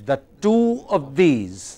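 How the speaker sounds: slow lilt, "two" stretched and falling; sing-song. Shown as a demonstration. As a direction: hold "two", then let "these" trail long. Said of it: "it's like sing song".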